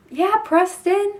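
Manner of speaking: high excited yell of the town name; planned scream. Retold or imitated. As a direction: shout it out to catch his attention. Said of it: "I'm gonna scream".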